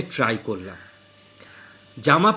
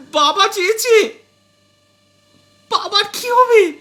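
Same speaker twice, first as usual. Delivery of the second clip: much higher, louder stunned wife; a shocked wife barely getting the words out; struggling to speak; 8 dB louder than usual. Acted. Wife gasps out her question to husband, much higher and stunned. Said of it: "তারপর অনেকক্ষণ পরে বহু কষ্টে বলল".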